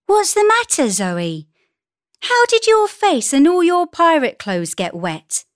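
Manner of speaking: high, surprised mother voice; concerned mother. A narrator's reading. Mother finding her daughter wet, surprised concern. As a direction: ask high with lively concern. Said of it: "her mother asked".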